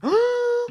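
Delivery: high mock gasp; shocked gasp.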